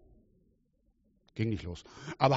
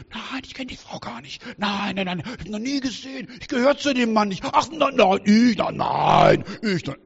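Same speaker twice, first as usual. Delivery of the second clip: higher, flustered stammering denial; panicky denying Peter; 6 semitones higher, 3 dB louder than usual. Retold or imitated. Preacher plays Peter denying Jesus in panic, higher and flustered. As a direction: deny it in a rush, cornered.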